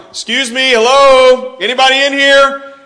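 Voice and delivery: calling out loud into a dark lobby; retold yelling. Young driver lost at night calls for anyone in the lobby, loud yelling. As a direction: shout it high into the empty hotel. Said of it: "I just start yelling".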